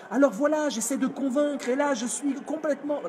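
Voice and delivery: anxious over-eager pitch; version one, unconvincing. Shown as a demonstration. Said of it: "Regardez la version un".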